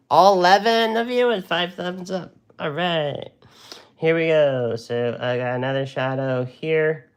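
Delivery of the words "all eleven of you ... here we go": much higher and louder, bursting with cheer; rally the crowd like a host; excited greeting. Real, not acted. Painter greets eleven stream viewers, high and loud with excitement.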